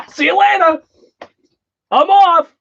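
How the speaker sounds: high, loud joking send-off; mock goodbye shout. Streamer pretending to leave for another stream, playful shouted farewell. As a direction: call out a hammed-up goodbye.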